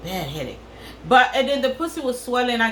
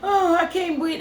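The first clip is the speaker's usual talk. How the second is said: high, whiny mock distress with a laugh; mocking the melodramatic patient, half crying half laughing; hammed-up dramatic patient; 9 semitones higher than usual. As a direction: overplay her pain for laughs. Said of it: "she's so dramatic".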